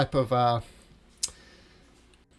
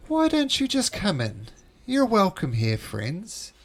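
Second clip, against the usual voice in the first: high sing-song host, falling at the ends; beckoning unseen voice; 7 semitones higher, 4 dB louder, slower than usual. Acted. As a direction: swoop up high, then glide down invitingly. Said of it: "You hear coming from inside this room".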